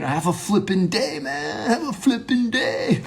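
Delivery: high, put-on goofy send-off; goofy farewell voice. Monologist playfully trying out a silly goodbye, goofy and high. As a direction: push it way up, mock-enthusiastic.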